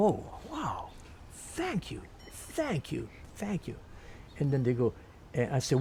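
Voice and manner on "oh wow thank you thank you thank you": high, hushed, awed thank-yous; small soft voice, acting out puzzled gratitude; bewildered thanks. Pianist to admiring tourists he doesn't know, hushed bewildered thanks.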